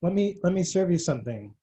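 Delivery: host serving Lovecraft fans, a touch sinister; mock host. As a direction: offer the dish like a gracious villain.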